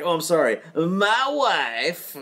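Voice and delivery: lightly voiced Borat apologising; Borat impression. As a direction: play a sheepish Borat "my wife". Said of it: "And like".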